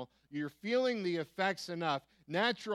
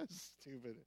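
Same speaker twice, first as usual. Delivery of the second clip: amused mutter; 4 semitones lower, 16 dB quieter than usual. Retold or imitated. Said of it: "And he's just".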